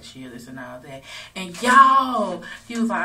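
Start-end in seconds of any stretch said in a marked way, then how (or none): 0.0-1.0 quiet hushing. Retold or imitated.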